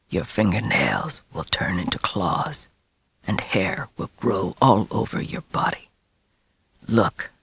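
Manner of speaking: low, breathy hushed whisper. A narrator's reading. Werewolf father whispering the boy's fate into his ear. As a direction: whisper it like a curse. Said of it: "whispering Joey's future relentlessly".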